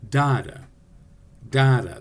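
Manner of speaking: "Da-da" with a strong first syllable, weak second; DA-da, falling away after the hit; first-syllable accent. Shown as a demonstration. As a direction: punch the first "da" of each "Da-da". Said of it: "That first syllable is accented".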